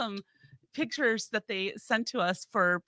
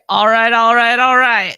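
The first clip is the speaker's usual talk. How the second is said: loud callout; 14 dB louder than usual. Real, not acted. Host calls the show to order.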